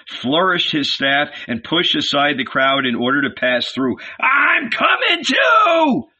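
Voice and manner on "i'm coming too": high, drawn-out holler; slow, high-pitched eager yell; calling out. A narrator's reading. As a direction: holler wildly from the crowd. Philip calls out joining.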